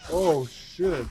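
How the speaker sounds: loud, sudden oh shit at the crowd; startled outburst. Real, not acted. Streamer sees a crowd of players, loud shock. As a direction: blurt it out, caught off guard.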